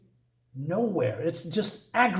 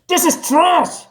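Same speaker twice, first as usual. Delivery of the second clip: a sudden high, loud yell of disgust; outraged shout; 11 semitones higher, 7 dB louder, slower than usual. Real, not acted. Reviewer shouting his verdict on the comic, loud and disgusted. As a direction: blurt it out at full volume, fed up.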